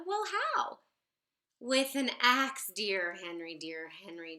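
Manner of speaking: ordinary delivery, nothing marked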